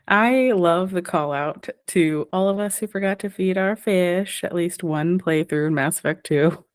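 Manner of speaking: ordinary delivery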